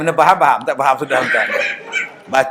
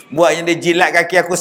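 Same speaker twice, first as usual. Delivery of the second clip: ordinary delivery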